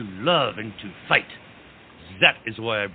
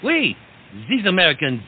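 excited; 7 semitones higher, 5 dB louder than usual. Acted. Lafayette's excited proclamation.

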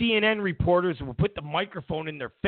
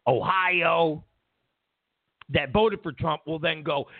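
ordinary talk, nothing marked

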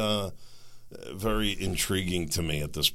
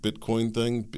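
normal talking voice

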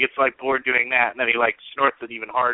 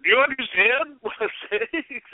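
laughing mock quote; 12 semitones higher than usual. Mocking indifferent official, laughing.

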